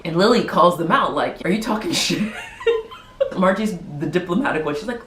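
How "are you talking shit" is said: mock accusation dissolving into laughter; laughing mock confrontation. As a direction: imitate a challenge, cracking up midway. Acted confrontation, breaking into laughter.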